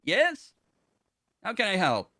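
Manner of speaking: chirpy assistant answering a call; helpful ship AI. Acted. GM voices ship computer Tonic.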